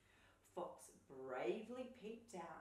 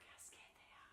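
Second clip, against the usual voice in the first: whisper; 15 dB quieter than usual. Acted. Reader whispers in suspense.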